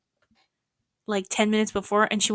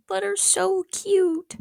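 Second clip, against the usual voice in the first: cooing at dog; 10 semitones higher, much slower than usual. Real, not acted. Cooing over her dog.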